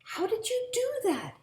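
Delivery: high, loud amazed mother. A narrator's reading. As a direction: ask in delighted surprise. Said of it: "asked his mother".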